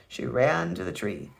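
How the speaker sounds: ordinary delivery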